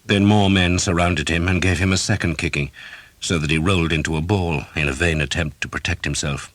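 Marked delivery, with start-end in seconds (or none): none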